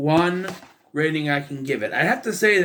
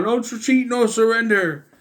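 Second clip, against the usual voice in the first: high-pitched rallying shout; 7 semitones higher, 3 dB louder than usual. Retold or imitated. R J shouting encouragement to a losing fighter, shouting. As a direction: imitate a shouted battle cry. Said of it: "R J shouting out".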